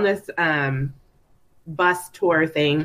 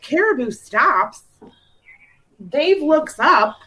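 slow, higher storybook telling; over enunciating; 7 semitones higher, slower than usual. Pretend. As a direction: tell it like to a five-year-old. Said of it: "talk to me like I'm five".

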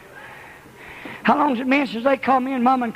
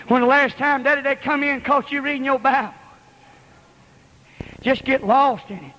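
usual speaking voice

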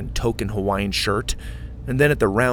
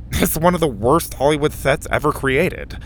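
put-on higher lisping kid voice; Mac's voice; 5 semitones higher, 4 dB louder than usual. A narrator's reading. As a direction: keep Mac's lisp going.

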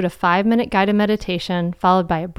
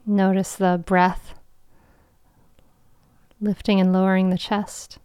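guided meditation. Acted. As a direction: guide them into calm, with long pauses.

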